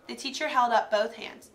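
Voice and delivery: ordinary delivery, nothing marked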